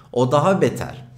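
usual speaking voice

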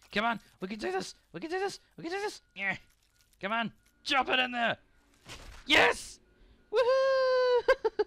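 very high, loud rallying shouts; rally yourself with wild cheers; excited cheering. Real, not acted. Final push, cheering self.

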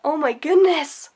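ordinary delivery

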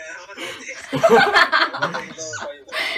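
loud, high laughing fit; burst of laughter. Real, not acted. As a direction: crack up laughing. Laughing at a friend's reaction.

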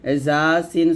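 ordinary talk

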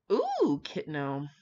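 normal talking voice

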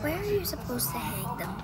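normal talking voice

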